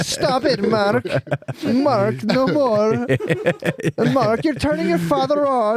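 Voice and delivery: high-pitched mock mother scolding her son; scolding French mom. Mom telling her son Mark to stop, high mock-French scolding. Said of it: "Then his mom just, like, grabs his balls".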